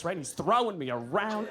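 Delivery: ordinary delivery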